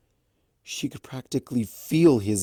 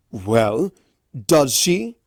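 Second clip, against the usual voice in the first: louder stallion voice pressing; pushy demand; 2 semitones higher, 6 dB louder, much slower than usual. A narrator's reading. Raspberry pressing the others, pushy. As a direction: demand an answer. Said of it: "Raspberry asked".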